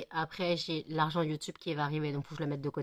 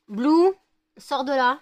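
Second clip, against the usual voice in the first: loud, high call; calling the dog; 8 semitones higher, 12 dB louder, slower than usual. Real, not acted.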